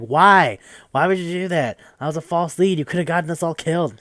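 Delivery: high, loud incredulous protest; indignant outburst. Retold or imitated. Teammates confronting the rich kid over a false lead, indignant.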